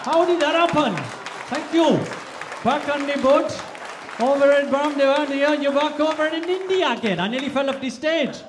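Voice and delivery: high, loud Indian-Welsh sing-song; frantic, high Indian-Welsh accent; excited Indian-Welsh accent. Shown as a demonstration. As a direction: belt it out in a Welsh-Indian lilt. Frantic climax of accent tour.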